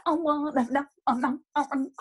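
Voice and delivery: high mock jazzy wail.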